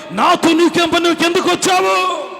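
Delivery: high, loud demonic protest; strained high yell, last word held; Satan challenging Jesus. Acted. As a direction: shout it as a cornered devil. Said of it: "ఏమని మాట్లాడుతుంది యేసయ్యతో".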